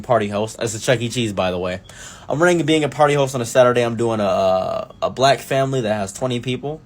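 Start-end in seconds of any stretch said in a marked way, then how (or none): none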